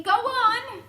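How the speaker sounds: loud, high, desperate shout; frantic cry. A narrator's reading. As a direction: urge him on in a panic. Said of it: "they cried frantically".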